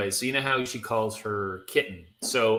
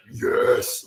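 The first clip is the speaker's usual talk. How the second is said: guttural demon. Shown as a demonstration. Co-host showing how the Phoenix should sound, guttural demon. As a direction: growl it like a darkened, demonic voice. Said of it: "it should be like the most guttural demon".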